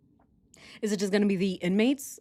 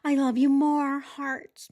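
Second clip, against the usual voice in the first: a higher, put-on voice mimicking a lovesick reply; mock the sappy reply higher than her own voice; mocking Scott; 7 semitones higher, slower than usual. Teasing Scott's sappy line, a higher mocking voice. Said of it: "He's like".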